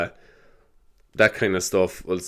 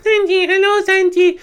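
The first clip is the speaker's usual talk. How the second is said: put-on much higher, louder child voices; squeaky kids; 4 dB louder, slower than usual. Kids calling out to Santy on the telly, high and squeaky. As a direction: squeal it like small excited kids. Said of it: "the kids will be like".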